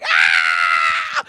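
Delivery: loud, short panicked yell; terrified scream. Retold or imitated. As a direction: let out a roller-coaster scream. Screaming on ride.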